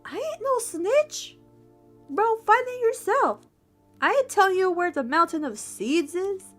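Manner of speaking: high-pitched sassy little ant; sassy refusal. Acted.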